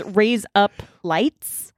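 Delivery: "raise up lights" slowly, word by word; slowed Australian demo. Shown as a demonstration. Explaining an Australian accent trick, slow demonstration. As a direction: spell out the Aussie trick slowly. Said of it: "Just really fast".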